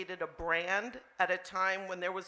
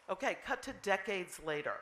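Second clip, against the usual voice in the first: ordinary talk, nothing marked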